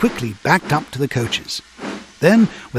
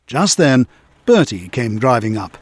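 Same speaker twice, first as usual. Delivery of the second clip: usual speaking voice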